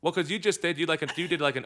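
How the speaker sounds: ordinary talk, nothing marked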